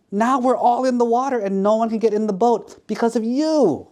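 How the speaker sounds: mad scolding. Acted. Soaked girl blaming prankster for stranding everyone, angrily. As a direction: start measured, build into high indignant blame. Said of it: "the girl was so mad".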